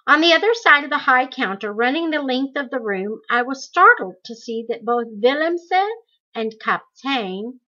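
plain reading voice

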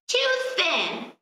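high, grumbling crone; complaining witch. Acted. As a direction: whine it with disgust. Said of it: "she complained".

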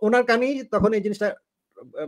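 usual speaking voice

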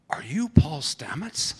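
a hint of a young girl's questioning; imitating little girl. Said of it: "seven-year-old girl".

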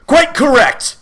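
high, loud crow of triumph; triumphant shriek. A narrator's reading. The villain confirms Milo's guess, shrieking triumphantly. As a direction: shriek it out, exultant. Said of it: "he shrieked triumphantly".

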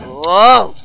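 usual speaking voice

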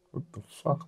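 soft, bewildered aside; stunned undertone. Retold or imitated. Manager caught by police on the stoop, stunned undertone. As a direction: say it under the breath, thrown. Retold startled reaction to police.

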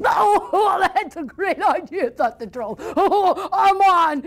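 high troll laughing, eager; gleeful troll. Acted. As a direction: jump at the contest with a cackle. Troll accepts eating contest.

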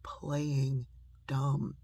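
slow, spaced-out admonition; measured parental reproof; mother's firm saying. Retold or imitated. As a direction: deliver it word by word, deliberately. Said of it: "I heard my mother saying that".

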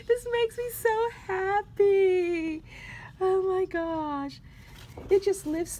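emotional happy gush; choked-up happiness; overjoyed. Real, not acted. Gushing over cute papers.

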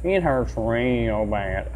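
slow, soft groaning Cleveland; a touch of Cleveland in pain. Acted. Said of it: "how he became Cleveland".

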